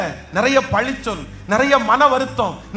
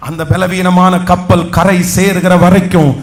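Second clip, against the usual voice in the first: loud, lower proclaiming voice, slowing; full-force preaching; 4 semitones lower, 6 dB louder than usual. Acted. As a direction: proclaim it loudly over the crowd. Preacher proclaims strength, claps. (உரத்த குரலில் ஆவேச பிரசங்கம்)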